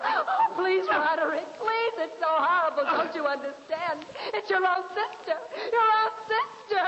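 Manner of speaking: high, pleading distraught voice; tearful pleading. Acted. Dina begging Roderick to spare his sister. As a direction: beg through rising panic.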